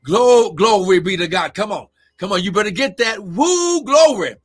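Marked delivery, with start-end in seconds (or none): none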